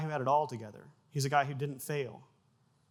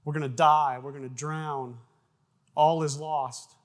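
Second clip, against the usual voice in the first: mock panic; 8 dB louder, slower than usual. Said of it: "They're freaking out".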